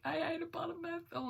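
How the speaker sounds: very high, small confessing voice; squeaky confession. Retold or imitated. Patient confessing to nurses in a very high voice. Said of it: "And I was like".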